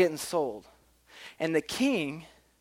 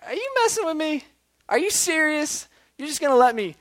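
high-pitched, incredulous servant; acted disbelief; 12 semitones higher, 4 dB louder than usual. Forgiven servant asks the king if it's real, incredulous. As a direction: ask it way up high, as if stunned. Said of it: "looking at the king and maybe being like".

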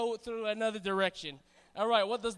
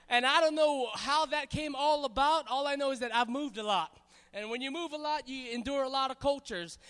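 normal talking voice

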